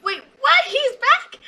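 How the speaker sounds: sudden high startled disbelief; surprised. Retold or imitated. Vlogger retelling her reaction to the return, high surprised squeak. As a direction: squeak out the shock of the comeback. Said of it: "I'm like".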